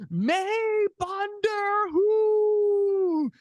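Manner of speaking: high, very slow, stretched-out arena call; announcer repeating his Punjabi line, stretched long; drawn-out announcer call, again. Retold or imitated. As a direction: repeat it just as long and proud. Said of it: "I say it again in the microphone".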